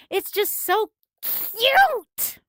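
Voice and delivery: high, loud, drawn-out "cute"; overexcited, stretching "cute" into a squeal; gushing squeal. Real, not acted. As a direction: gush until the last word rings off the walls. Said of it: "heard my voice ring from being bounced off my walls".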